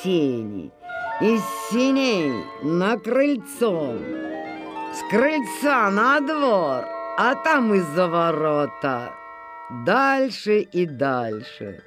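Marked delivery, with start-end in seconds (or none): none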